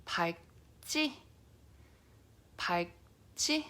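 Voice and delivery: 밝지 with the L cut by a G stop; 밝지, tongue tries L then the back shuts it; careful 밝지, L swallowed into G before 지; demo pronunciation. Shown as a demonstration. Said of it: "this word is pronounced as".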